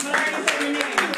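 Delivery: normal talking voice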